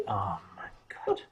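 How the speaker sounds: whispered, low and slightly amused; hushed exclamation. Retold or imitated. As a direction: whisper it under your breath in mock shock. Imitated hushed reaction to someone.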